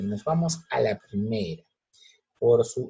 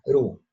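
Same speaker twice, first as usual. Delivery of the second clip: "Perú" with a louder, forceful "rú"; stress on final syllable; 5 dB louder than usual. Shown as a demonstration. Teacher confirming the tonic syllable, forceful "rú" in "Perú". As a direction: lean hard on the end of "Perú". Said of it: "la que tiene mayor fuerza de voz".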